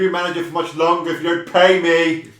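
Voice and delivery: loud, high exasperated complaint; exasperated manager. Acted. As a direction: complain about unpaid wages. In-character money quarrel.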